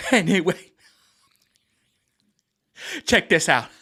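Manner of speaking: a mock Kevin Hart, loud and high. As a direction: ham up a stand-up bit. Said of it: "he just be like this".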